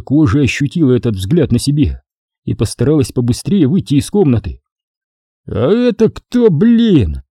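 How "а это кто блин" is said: put-on slow, slurring drunk voice; tongue tripping drunkenly over words; slurred drunk speech. A narrator's reading. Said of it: "заплетающимся языком спросил Михай".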